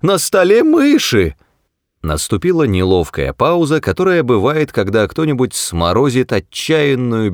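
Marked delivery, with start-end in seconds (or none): 0.0-1.4 high, startled outcry; sudden high-pitched cry of alarm; sudden yell. A narrator's reading. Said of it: "и внезапно завопил".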